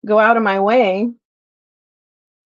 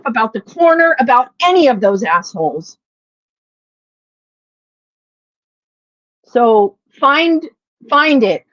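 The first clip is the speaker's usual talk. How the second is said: angry rant; 6 semitones higher, 5 dB louder than usual. Real, not acted. Ranting at former online friends.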